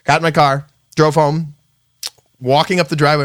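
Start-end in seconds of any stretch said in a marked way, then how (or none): none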